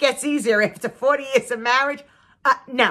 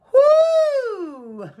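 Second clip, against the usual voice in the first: whoo; 6 dB louder than usual. Real, not acted. Exhausted by husband's farming.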